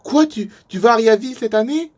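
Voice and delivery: high, loud, stammered disbelief; astonished question. Acted. Camper learning the other returns to his town, astonished. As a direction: blurt out an amazed question. Acted astonishment at shared destination.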